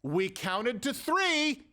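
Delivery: counting off slowly like a patient parent; mock warning. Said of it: "That was us saying, like".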